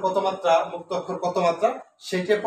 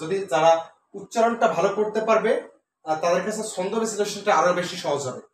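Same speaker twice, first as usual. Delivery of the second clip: ordinary delivery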